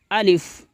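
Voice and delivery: الف said short and quick, not stretched; short quick alif. Shown as a demonstration. Teacher shows children the correct quick الف. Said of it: "جلدی پڑھیں گے".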